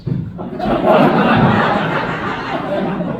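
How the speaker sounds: loud, high burst of laughter; big laugh. Real, not acted. Reading the note to the audience, laughing loudly.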